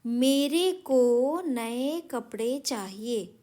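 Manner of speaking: each word dragged out to show the error; teacher reads the wrong sentence slowly and loudly; slow reading. Shown as a demonstration. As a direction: read the faulty sentence slowly. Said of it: "थोड़े अटपटे लग रहे हैं".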